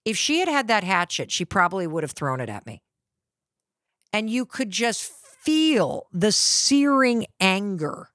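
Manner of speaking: normal talking voice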